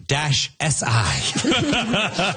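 very slow, word by word; slow teleprompter reading. Retold or imitated. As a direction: read it stiffly off a teleprompter. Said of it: "he read off the teleprompter".

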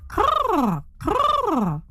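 high croaking caw; raven croak. Acted. Raven call in story.

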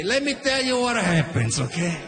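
dad spinning a dramatic yarn for his son; Italian dad storytelling. Retold or imitated. Dad's Easter bunny tale.

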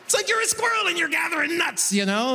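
high-pitched mocking banker voice; banker's patronizing voice. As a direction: imitate a banker explaining it to a child. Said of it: "that's the voice he's using too".

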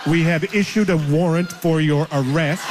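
stiff recorded-voice scam call, laughing through it; mock automated government message; robot voice. Imitating the robocall warning him, flat and mechanical. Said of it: "a robot voice saying".

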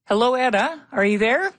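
high, questioning hello down the line; high-pitched phone check-in; calling out to caller. Real, not acted. Host calling to unheard phone caller.

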